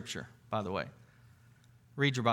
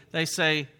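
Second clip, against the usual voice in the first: normal talking voice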